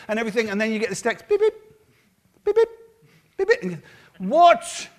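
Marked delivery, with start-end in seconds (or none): 1.3-3.6 short spoken phone beeps; imitated text alert. 4.2-4.9 high, loud, incredulous yelp; shocked outburst. Acted. Acts leader's reaction to text.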